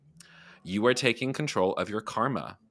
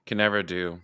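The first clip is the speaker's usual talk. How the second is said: usual speaking voice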